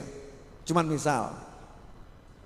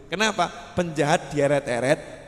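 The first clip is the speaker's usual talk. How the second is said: usual speaking voice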